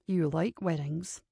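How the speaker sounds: low, slow, quiet accusing question; mild accusation. A narrator's reading. Kincaid just realizing Ava likes weddings, low accusation. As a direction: accuse softly, drawn out and low. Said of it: "he accused".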